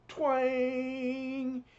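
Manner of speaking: high, loud springy "twang"; twang sound effect. Acted. A reader acting out the spring's release with a high "twang". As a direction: sing out the spring's twang like a cartoon.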